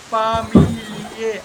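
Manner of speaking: "Familie" slow, every vowel given full value; drawn-out teacher's "Familie"; equally pronounced vowels. Shown as a demonstration. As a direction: sound out "Familie" syllable by syllable. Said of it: "All vowels are equally pronounced".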